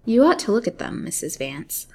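ordinary delivery, nothing marked

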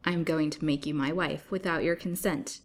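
ordinary delivery, nothing marked

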